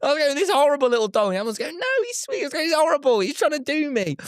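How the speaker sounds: high-pitched comic exasperation; appalled squeal. Real, not acted. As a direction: rant in a squeaky, incredulous register. Exasperated, appalled retelling of ordeal.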